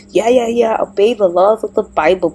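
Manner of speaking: normal talking voice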